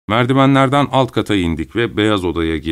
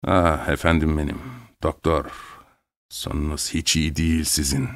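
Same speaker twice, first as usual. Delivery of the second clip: softer and lower, full of scorn; scornful; 5 semitones lower, 7 dB quieter than usual. A narrator's reading. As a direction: talk down to the doctor with quiet contempt. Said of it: "Küçümser bir tavırla ekledi". (küçümseyen, alçak sesle)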